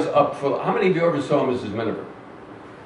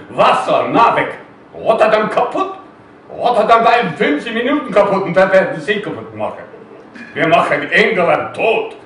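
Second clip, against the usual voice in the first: loud, higher-pitched German tirade, dropping at the end; menacing German airman; 5 semitones higher, 6 dB louder than usual. Acted. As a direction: rant in German like a wounded enemy flyer. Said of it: "and it went like this".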